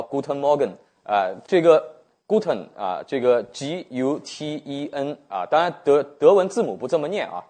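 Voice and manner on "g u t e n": "G U T E N" spelled slowly with English letter names; slow, separated English spelling of "G U T E N". Shown as a demonstration. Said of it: "用英文念法".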